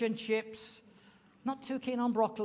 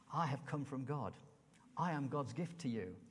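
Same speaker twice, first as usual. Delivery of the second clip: quiet mock boast; 7 semitones lower, 7 dB quieter than usual. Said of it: "It does sound a tad big-headed".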